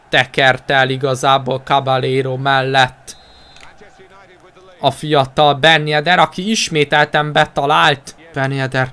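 ordinary talk

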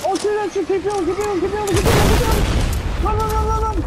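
frantic high shouting; being chased. Real, not acted. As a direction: shout urgently, over and over.

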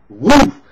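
loud, high burst sound effect; sudden whoosh. Retold or imitated. Woman bursting out of the dark bedroom, loud startled whoosh. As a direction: blurt the noise of something leaping out.

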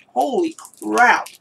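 stunned, slow, drawn out; shocked by sour candy. Real, not acted. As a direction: hit by the sourness. First bite of sour candy.

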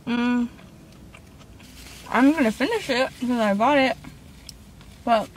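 talking while chewing; muffled through napkin; mouth full, chewing. Real, not acted. Seen 1: Cheeks full, lips closed chewing; napkin pressed to mouth from 2.1s.

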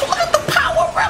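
high, amazed shout at the screen; hyped exclamation. Real, not acted. Watching the power-up, a high excited shout. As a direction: blurt it out in awe.

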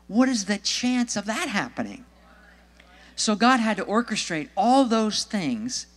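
high, emphatic sermon delivery; animated preaching. Acted. Preacher showing how God orchestrated it all, animated.

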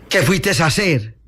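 ordinary delivery

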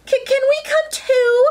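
very high, stammered plea; eager squirrel. Acted. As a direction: ask high, tripping over the first word. Squirrel begs to join.

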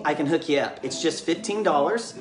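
ordinary talk, nothing marked

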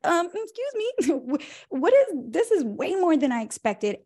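high, halting polite objection; lightly voiced friend asking what happened; a hint of embarrassed disbelief; awkward complaint.

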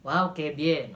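ordinary talk

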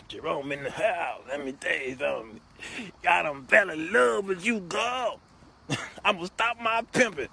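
put-on showy player voice; Jerome in the House. TV character confessing love to a woman, showy impression. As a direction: play a smitten pimp, rising as he confesses. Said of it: "And he like".

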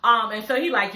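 ordinary talk, nothing marked

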